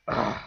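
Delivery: loud imitated "ugh" of frustration; exasperated groan. Imitating a voter freaking out in the back, exasperated groan. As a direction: groan like someone losing patience. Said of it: "this guy's freaking out".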